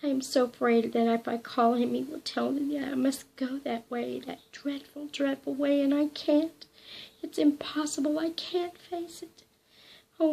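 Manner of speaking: terrified woman begging not to go; frightened pleading. Acted. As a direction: plead in mounting dread, slowing at the end. Frightened heroine pleads in despair.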